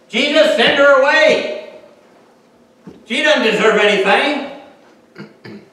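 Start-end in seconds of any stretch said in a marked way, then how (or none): 0.1-4.6 disciples' demand. Retold or imitated. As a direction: push her away loudly. Disciples rejecting her.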